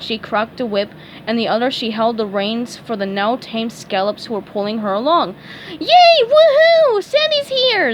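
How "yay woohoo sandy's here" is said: very high, loud joyful cheer; excited cheer. A narrator's reading. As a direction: cheer as rescue comes. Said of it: "SpongeBob called".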